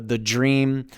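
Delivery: ordinary delivery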